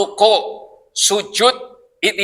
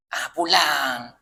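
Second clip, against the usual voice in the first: soft, slow phone whisper; demo whisper; 5 semitones lower, 6 dB quieter, much slower than usual. Shown as a demonstration. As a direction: hush it like a secret call. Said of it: "Gitu bisik ya?". (bisikan pelan dan lambat)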